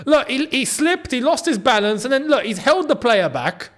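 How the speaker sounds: worked-up football fan making the case; animated argument. Real, not acted. Protesting a foul during the match.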